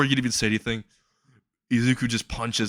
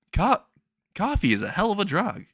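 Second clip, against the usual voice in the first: high-pitched, stuttered start; jumpy, pitched-up comic delivery; wired blurting; 9 semitones higher than usual. Retold or imitated. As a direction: blurt it like a coffee-crazed kid. Jittery kid asked about drugs.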